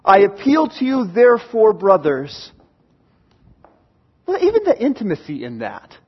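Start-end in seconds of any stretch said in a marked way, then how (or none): 0.0-2.5 earnest appeal. Acted. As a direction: appeal urgently, pushing the words out. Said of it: "you can picture Paul on his knees".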